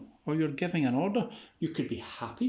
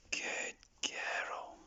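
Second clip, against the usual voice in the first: whispered praise; 8 dB quieter than usual. Real, not acted. Owner praising her dog Molly, soft whisper. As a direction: hush the praise to a calm dog.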